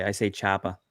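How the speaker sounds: Choppa, ending in an open vowel; dropped final R. Shown as a demonstration. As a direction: say Choppa without the R at the end. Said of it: "how I, how I say".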